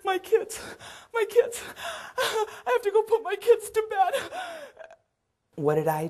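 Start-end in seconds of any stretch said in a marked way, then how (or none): 0.0-4.3 high, loud, near-crying panic; anxious, rising voice. Retold or imitated. Mabel suddenly begging the caregiver to let her go, high and tearful. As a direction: plead urgently like a frightened mother. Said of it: "her voice tone goes up".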